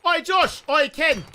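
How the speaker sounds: loud, high-pitched hail; calling out. Real, not acted. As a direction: holler to get their attention. Calling out to two others.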